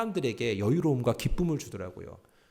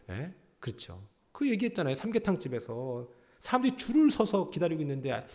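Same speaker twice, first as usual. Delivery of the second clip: ordinary delivery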